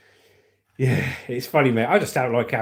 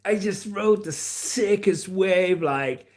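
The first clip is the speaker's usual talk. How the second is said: higher, slow, stretched surfer-dude drawl; California surfer; 3 semitones higher, much slower than usual. Acted. Streamer adding surfer for Cali, drawling surfer dude. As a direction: drag out the vowels like a surfer. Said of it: "throw some surfer in there for Cali".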